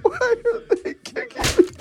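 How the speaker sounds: high, laughing mock whine of a bewildered friend; confused Eli. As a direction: play the hurt, puzzled victim through your own giggles. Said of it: "he's like".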